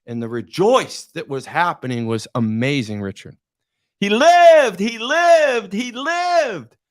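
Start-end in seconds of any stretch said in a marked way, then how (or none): 4.0-6.8 high, loud triumphant cry, repeated; joyful shout. Retold or imitated. Players celebrating the man crossing the line, joyful shout. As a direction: shout it like the crowd rejoicing.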